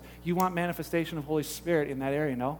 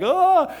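mock exclamation; 10 semitones higher, 7 dB louder than usual. Mocking Acts chapter two believers.

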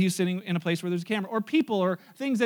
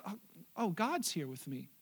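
soft, low murmur to himself; quiet realization; 4 semitones lower, 11 dB quieter than usual. Acted. Monk to himself over the potatoes, quiet realization.

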